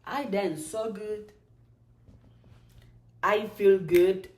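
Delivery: normal talking voice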